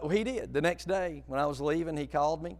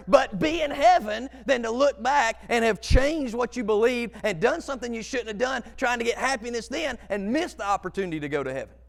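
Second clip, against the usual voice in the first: higher, louder, driving sermon voice; preaching at full force; 8 semitones higher, 9 dB louder than usual. Acted. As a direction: preach it out, building to heaven.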